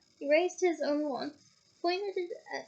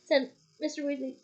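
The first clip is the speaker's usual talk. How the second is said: ordinary delivery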